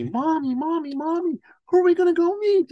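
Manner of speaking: put-on high, excited child voice; imitating a curious, energetic small boy; eager little boy. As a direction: pester Mom, pitch climbing with each Mommy. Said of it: "You know how little boys get".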